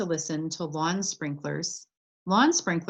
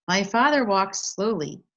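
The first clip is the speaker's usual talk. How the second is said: plain reading voice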